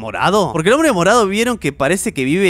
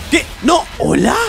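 normal talking voice

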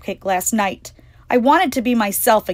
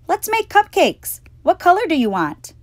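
sweet mom asking her daughter; Mommy's voice; 11 semitones higher, 4 dB louder than usual. Acted. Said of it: "Mommy said".

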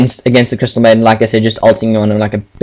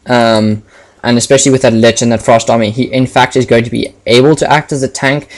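ordinary delivery

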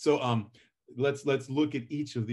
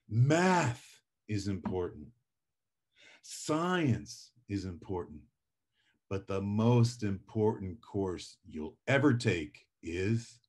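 long dramatic pauses between phrases, slow and dropping lower; 3 semitones lower, slower than usual. Shown as a demonstration. Teacher demonstrating the emphasis pause to students, dramatic and slow. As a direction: let each pause hang before public speaking. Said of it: "it's a bit dramatic".

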